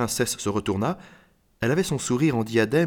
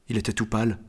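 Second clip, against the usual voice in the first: ordinary delivery, nothing marked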